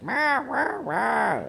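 high, drawn-out meow imitation; cat meowing. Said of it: "he was like".

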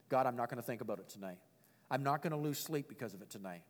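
low, soft private prayer; quiet prayer. Retold or imitated. Preacher praying at night to surrender worries, quietly. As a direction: hand it over to God softly.

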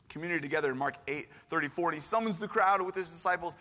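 ordinary talk

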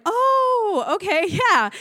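high, loud drawn-out dawning; awkward realization. Retold or imitated. Girlfriend realizes boyfriend means the dog, awkward realization. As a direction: overplay the sudden understanding with a laugh.

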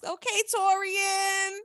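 high, drawn-out whoop of approval; hyped cheering. Real, not acted. Podcaster cheering on Torian's gesture, hyped.

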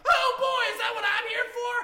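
bubbly high helper voice, shouted; Mister Meeseeks. Acted. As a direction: chirp about the murder like an eager servant. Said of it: "the other one says".

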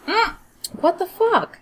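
high, incredulous what the; shocked disbelief. Real, not acted. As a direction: react like you can't believe it. Reacting to insult.

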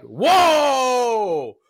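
high, loud drawn-out whoa of disbelief; a startled cry pulling back from the screen; shocked whoa. Retold or imitated. Viewer shocked at the anime's turn, loud high whoa. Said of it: "And I'm like".

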